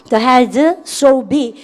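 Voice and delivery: very slow model reading; slow drill read. Shown as a demonstration. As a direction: read it out slowly for the class to follow. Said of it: "시작". (천천히 또박또박 시범 읽기)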